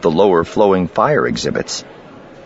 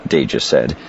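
ordinary delivery

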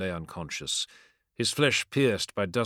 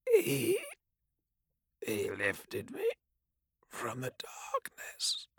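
faint dying voice; 3 semitones higher, 7 dB quieter, slower than usual. Acted. Dying captain speaks faintly.

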